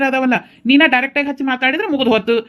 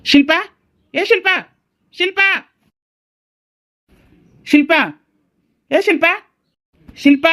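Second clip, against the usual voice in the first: higher-pitched searching call; 7 semitones higher than usual. Acted. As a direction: call out for your missing wife. Husband calls out searching for wife.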